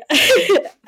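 high emotional laugh burst; teary high laugh. Real, not acted. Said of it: "let me compose myself".

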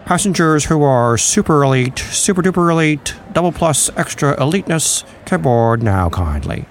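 put-on grand old TV host calling boarding groups; Ed Sullivan. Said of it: "I sound like Ed Sullivan".

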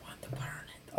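soft unvoiced whisper. Real, not acted. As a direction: whisper so it won't wake. Whispering beside sleeping monster.

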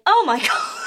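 a colleague's shocked reaction; lightly voiced shocked colleague; his mock shock. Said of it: "and he went".

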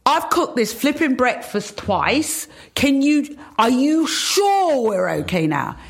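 mock indignation with drawn-out stress; retold exasperation. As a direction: challenge them, acting a little exasperated. Fed-up attendant challenging the engineer.

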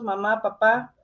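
ordinary talk, nothing marked

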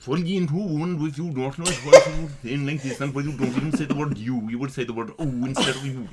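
thick mock Kerry accent, words run together; incomprehensible Kerry accent. Host showing off favourite Irish accent, incomprehensible Kerry. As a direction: garble it like an incomprehensible Kerry speaker. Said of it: "totally incomprehensible Kerry one".

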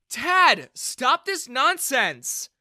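very loud, high, slow order to stop; commanding shout. A narrator's reading. Daughter shouts at her father to stop, loud and commanding. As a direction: shout him down hard.

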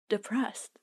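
mock flat echo of depressed; mock deadpan depressed. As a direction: echo it back like a bored therapist. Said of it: "And how do you feel about that?".

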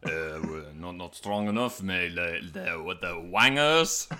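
put-on halting French-accented punditry; French football manager. Manager reviewing the match, in halting French accent. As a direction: search for words like a French manager. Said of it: "a football manager".

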